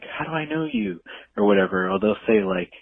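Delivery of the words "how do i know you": high, puzzled question; baffled how-do-I-know-you; confused stranger. Retold or imitated. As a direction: ask like you can't place them. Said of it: "they're like".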